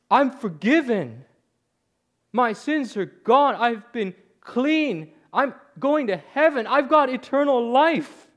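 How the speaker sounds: high, loud, drawn-out cries of joy; ecstatic shouting. Retold or imitated. Dying man realizes he is forgiven, ecstatic shouting. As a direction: proclaim it slowly, voice lifted in amazed jubilation. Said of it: "shaking and trembling and shouting about Jesus".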